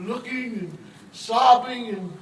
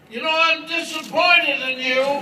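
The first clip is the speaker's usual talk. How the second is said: louder mock complaint, laughing; hammed-up disappointment; 4 semitones higher, 8 dB louder than usual. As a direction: call after him, mock-offended. Man calls after departing Jesus.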